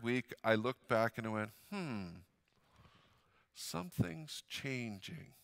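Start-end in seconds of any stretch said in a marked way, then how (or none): 1.7-5.3 soft and slow, half to himself; a quiet, slow murmur of discovery; hushed realization. Retold or imitated. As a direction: wonder dawning quietly.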